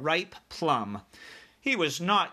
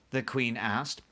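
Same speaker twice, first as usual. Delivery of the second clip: ordinary delivery, nothing marked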